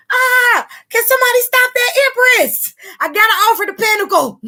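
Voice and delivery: high, loud thrilled cry; excited shout. Real, not acted. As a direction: shout it out overjoyed. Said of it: "I'm sorry. I'm excited".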